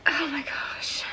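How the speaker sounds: soft breathless whisper. Real, not acted. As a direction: whisper in disbelief. Stunned by the book.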